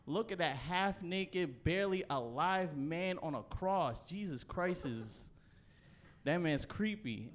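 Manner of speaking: slow, dazed stoner musing at the cross; slow stoned wonder, gently mocking. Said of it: "looking up in the air and being like".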